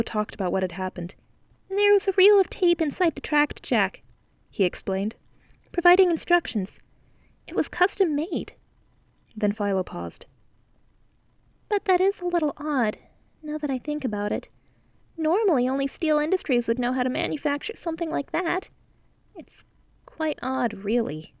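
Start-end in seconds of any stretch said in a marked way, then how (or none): 1.7-8.5 put-on higher young voice, explaining; a hint of a kid explaining; lightly voiced boy. A narrator's reading. Philo explaining the tape to Deuce, lightly voiced boy. 11.7-21.3 put-on higher young voice, explaining; a hint of a kid explaining; lightly voiced boy. A narrator's reading. Philo explaining the tape to Deuce, lightly voiced boy.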